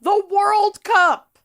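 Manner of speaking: high, slow, incredulous scoff; sarcastic drawn-out exclamation. Real, not acted. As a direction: stretch it out in mock disbelief.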